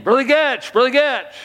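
high, loud call from a distance. Retold or imitated. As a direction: shout his name up the hill after him. Man calling from behind.

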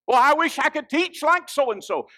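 high, loud preacher's quote; loud, high-pitched acted lament; mock wishing. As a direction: call out the wish at high pitch. Acting out a wishful complainer.

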